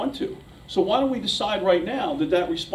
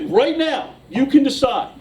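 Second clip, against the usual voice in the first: emphatic; 8 semitones higher, 5 dB louder, slower than usual. Retold or imitated. Child lecturing mom, retold.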